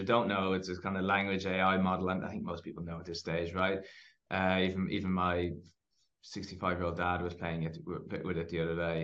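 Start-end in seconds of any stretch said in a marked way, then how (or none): none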